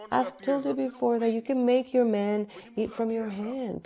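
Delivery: normal talking voice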